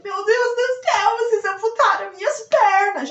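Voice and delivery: high, loud, near-tears shriek; panicked scream. Retold or imitated. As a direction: scream it as if your legs were gone. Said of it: "eu gritei". (grito desesperado, quase chorando)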